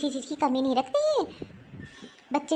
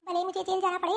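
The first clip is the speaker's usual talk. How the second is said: ordinary delivery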